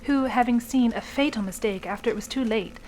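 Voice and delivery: ordinary talk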